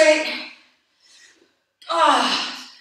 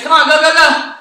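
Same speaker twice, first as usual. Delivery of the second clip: shouted hype, pushing the class; loud cheering; 7 dB louder than usual. Real, not acted. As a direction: yell them through the last reps. Trainer cheers on live class.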